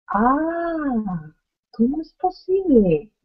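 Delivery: high ah and a high, disbelieving question; teacher's exaggerated astonishment; amazed exclamation. Shown as a demonstration. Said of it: "Interjección exclamativa".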